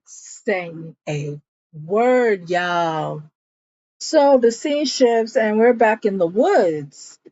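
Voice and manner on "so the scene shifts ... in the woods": normal talking voice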